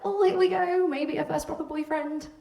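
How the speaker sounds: high-pitched, sing-out anticipation; giddy hope. Retold or imitated. Girl hoping for a first proper boyfriend, giddy excitement. Hopeful before playing the voicemail.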